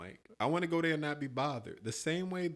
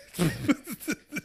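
loud laugh; 8 semitones higher, 3 dB louder than usual. Real, not acted. Laughing at story.

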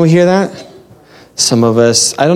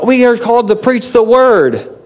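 much higher, proclaiming like a zealous street preacher; mock street preacher; 8 semitones higher than usual. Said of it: "they're like".

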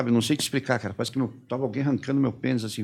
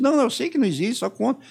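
high and loud, pressing him to talk; retold insistent shout; 10 semitones higher, 6 dB louder than usual. Interrogator presses the prisoner to just tell it, shouting. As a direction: hammer the order home, higher and louder.

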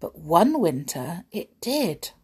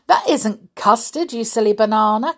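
louder teasing correction; laughing cry; 4 semitones higher, 6 dB louder, faster than usual. Acted. Mister Silly correcting his friend, loud teasing cry. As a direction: tease him loudly. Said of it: "cried Mister Silly".

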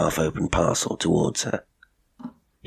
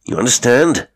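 loud, higher, sharp check; demanding; 5 semitones higher, 7 dB louder than usual. A narrator's reading. Colonel finishing his orders to the cook, demanding. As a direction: demand that she understands.

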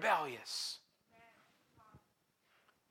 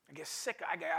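ordinary delivery

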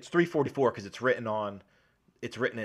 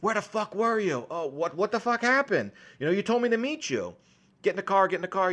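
higher, sharper mock yelling at a partner; heated confrontation; 8 semitones higher, 3 dB louder than usual. Undercover cop snaps at his partner in the car, mock angry. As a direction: fire the questions off like an angry cop. Acted quarrel after rooftop killing.

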